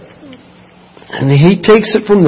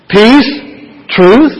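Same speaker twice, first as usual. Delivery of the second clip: ordinary talk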